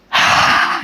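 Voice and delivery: long demonstrative exhale; deep sigh. Shown as a demonstration. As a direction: heave a deliberate sigh. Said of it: "kita menghela nafas dulu".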